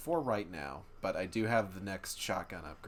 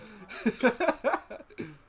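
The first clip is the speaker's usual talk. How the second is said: loud laugh; 14 semitones higher, 9 dB louder than usual. Real, not acted. Laughing at dog.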